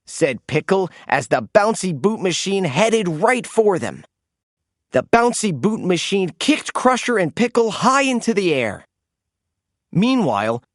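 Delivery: ordinary delivery, nothing marked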